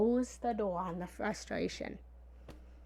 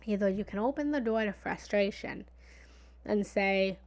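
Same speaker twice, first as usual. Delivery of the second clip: usual speaking voice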